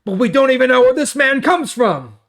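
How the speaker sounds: high, loud protest against the healed man; indignant Pharisees. Acted. Pharisees scoff at the healed man, high and loud. Scripture quote, Pharisees disputing.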